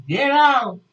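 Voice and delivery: high, loud acted shout; imitated furious yell; teacher yelling. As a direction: bellow it like an enraged teacher. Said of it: "She's yelling and yelling and yelling".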